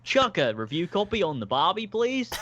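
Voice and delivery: high hammed-up Aussie voice, laughing; overdone outback YouTuber drawl; Australian accent. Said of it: "That's me pretending to be Australian".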